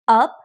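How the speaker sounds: "up" said with a rising pitch; second-tone rise. Shown as a demonstration. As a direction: lift the pitch right through "up". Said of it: "and goes up, like".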